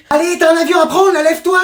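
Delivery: high, pressing wake-up call; urgent hustling. Acted. Parent wakes child for a plane, urgent. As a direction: hustle them up in a panic.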